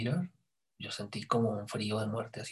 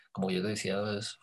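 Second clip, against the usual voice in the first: ordinary talk, nothing marked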